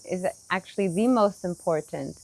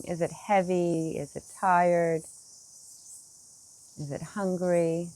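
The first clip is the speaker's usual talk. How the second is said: soft, unhurried meditation voice with long pauses; guided meditation; 2 semitones lower, 4 dB quieter than usual. Acted. Teacher guides meditators to notice the body, soft guided meditation. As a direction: gently invite them to feel the body.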